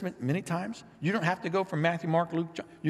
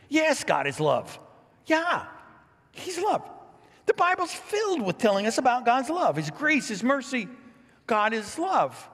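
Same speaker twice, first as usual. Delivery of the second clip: much higher and louder, beaming about God's love; joyful affirmation; 9 semitones higher, 4 dB louder than usual. Real, not acted. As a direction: lift it up like good news.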